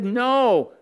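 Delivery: loud, high single forbidding word; emphatic no. Pretend. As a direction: slam the no down. God's firm prohibition.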